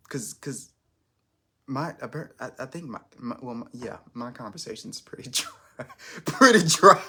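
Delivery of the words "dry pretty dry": louder, higher, breaking into a laugh. Real, not acted. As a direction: crack up while saying it. Laughs at own dull conversation.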